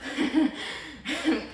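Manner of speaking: sudden loud, high laugh. Acted. Grieving child describing dad as an explorer, loud laugh. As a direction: burst out laughing mid-sentence.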